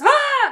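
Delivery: sudden high yelp of fright; a loud startled cry; imitated startled yell. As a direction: shriek it like a jump scare. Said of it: "all of a sudden he goes".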